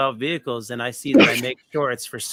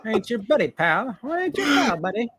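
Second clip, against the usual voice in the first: higher-pitched mock Canadian retort; playful put-on Canadian squabbling; Canadian impersonation; 8 semitones higher than usual. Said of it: "what are you doing in Canadian impersonation".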